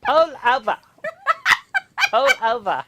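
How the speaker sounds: loud, high-pitched holler; mock shout. Joking around about accents, mock shouted order. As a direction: holler it as a put-on character.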